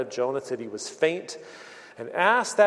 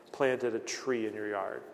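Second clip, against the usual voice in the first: usual speaking voice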